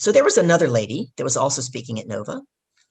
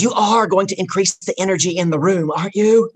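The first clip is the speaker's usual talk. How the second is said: raised, emphatic, pressing question; intense demand; 4 semitones higher, 4 dB louder than usual. Retold or imitated. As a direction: press hard for a yes, voice climbing at the end. Hirer in her face, demanding.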